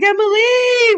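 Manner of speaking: high, loud stretched-out Emily; excited name call. Retold or imitated. Reading the attendant's all-caps message, calling the name excitedly. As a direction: drag out the name like a million Y's. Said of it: "And capital letters".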